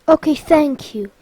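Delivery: usual speaking voice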